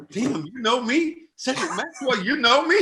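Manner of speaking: high-pitched, incredulous comedian impression; Lil Ray Howery. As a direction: imitate a starstruck man who can't believe he's recognized. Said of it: "That sounds just like him too".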